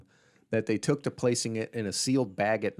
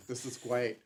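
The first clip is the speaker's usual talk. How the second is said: normal talking voice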